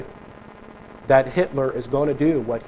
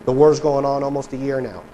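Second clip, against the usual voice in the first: normal talking voice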